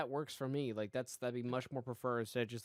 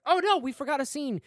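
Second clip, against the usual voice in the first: mock alarm; 11 semitones higher, 8 dB louder than usual. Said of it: "I was like".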